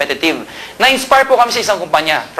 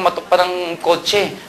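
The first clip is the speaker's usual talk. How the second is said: ordinary delivery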